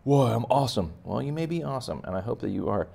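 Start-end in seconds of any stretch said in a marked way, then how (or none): 0.0-0.9 cocky student. Retold or imitated. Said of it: "You better not skip this step".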